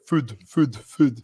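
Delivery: "food" parroted high with a clipped Scottish vowel; mocking Scottish food. Friends making fun of his accent, mock Scottish "food". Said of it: "they're always like".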